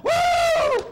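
high, loud whooping cry; ecstatic woo shout. Real, not acted. Preacher whooping to the church in ecstatic excitement. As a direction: let out a wild joyful whoop.

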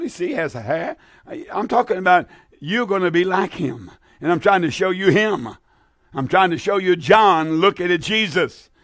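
normal talking voice

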